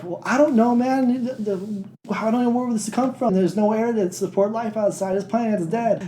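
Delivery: high-pitched imitation of a defensive scientist; mock flustered protest. Scientist answering mad sergeant, high mock protest.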